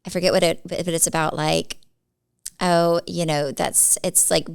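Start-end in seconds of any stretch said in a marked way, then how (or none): none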